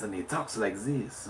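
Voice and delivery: hushed French-accented demo. Shown as a demonstration. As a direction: talk like a French character, voice dropped. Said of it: "he sometimes talks with a French accent".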